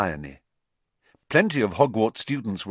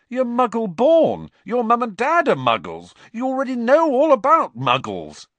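incredulous; 6 dB louder than usual. A narrator's reading. Ron mocks Hermione's subject choice.

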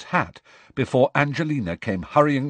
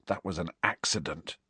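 softer, lower hushed misery; miserable whisper; 4 semitones lower, 8 dB quieter than usual. A narrator's reading. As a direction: confess it under the breath. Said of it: "he whispered miserably".

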